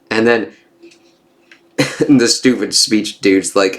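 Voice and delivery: usual speaking voice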